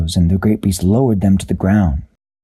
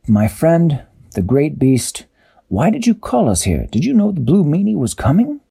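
lightly raised captain voice; Captain's voice; 6 semitones higher than usual. A narrator's reading. Captain Barnacle to the beast, lightly voiced question. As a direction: ask gently, a bit higher. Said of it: "Captain Barnacle said".

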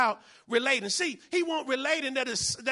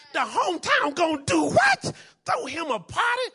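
loud, high incredulous question; acted shout of indignant astonishment; outraged disbelief; 5 semitones higher, 7 dB louder than usual. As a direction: demand it as if you can't believe it. Acts soldiers' outraged disbelief.

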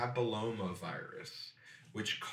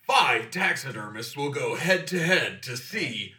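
higher, louder game show announcer; booming prize announcer; 5 semitones higher, 5 dB louder than usual. Pretend. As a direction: sell the grand prize like TV. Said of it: "Taxidermist War".

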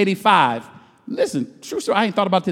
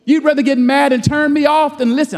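usual speaking voice

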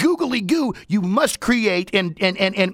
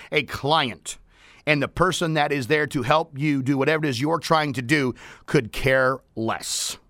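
ordinary delivery

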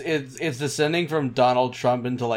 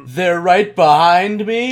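panto villain; 6 semitones higher, 9 dB louder, slower than usual. Pretend. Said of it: "Like a villain from a Panto show".

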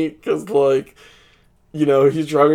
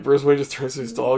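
normal talking voice

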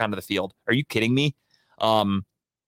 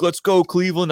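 crowd hype shout; 7 semitones higher than usual. Retold or imitated. Player pumping up the crowd.

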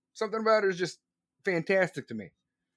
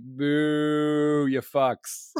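long sustained mock boo; booing crowd; much slower than usual. Crowd greeting Meghan and Harry as they walk down, mock booing. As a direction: imitate a crowd jeering with a drawn-out boo. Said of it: "They're like".

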